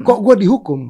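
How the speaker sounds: high, loud protest; indignant tiger. Acted. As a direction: demand why, outraged. (macan protes tak terima)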